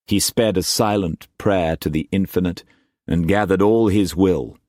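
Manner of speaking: usual speaking voice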